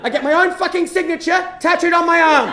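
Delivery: high, loud self-mocking rant; appalled comic yelling; incredulous outrage. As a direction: shout in disbelief at your own stupidity. Appalled at his own tattoo.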